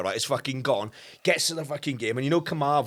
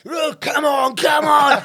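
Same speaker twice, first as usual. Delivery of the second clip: loud high call to rally the crowd; rallying shout; 11 semitones higher, 8 dB louder, much slower than usual. Retold or imitated. Dad urging the North Bank crowd onto the pitch, shouting. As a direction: belt it out to get everyone on.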